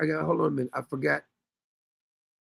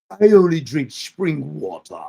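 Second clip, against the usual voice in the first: slow, louder, haughty British put-on; mock British accent; 6 dB louder, slower than usual. Host imitating her in the store, mock British accent. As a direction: stretch it out in exaggerated British, "wah-tah". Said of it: "She went British again".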